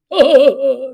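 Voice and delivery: loud acted chortle; troll laugh. Troll hearing of an eating contest, chortling.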